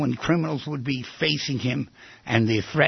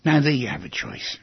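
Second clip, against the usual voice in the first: normal talking voice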